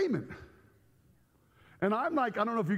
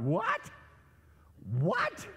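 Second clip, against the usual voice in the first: baffled; 3 semitones higher than usual. Retold or imitated. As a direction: act out total bafflement, pitch jumping up. Acts his first baffled reaction.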